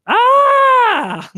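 high ghostly gasp of the grandmother spirit; ghostly shriek. Acted.

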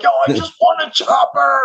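high, thrilled announcement; telling friends he won, high and giddy; excited brag. Retold or imitated. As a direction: blurt out the good news. Retelling telling friends his win.

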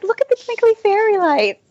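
high-pitched gushing imitation; mock wonder. Runner mimics friend spotting fairy lights, mock wonder. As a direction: coo with exaggerated, childlike delight.

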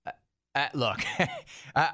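ordinary talk

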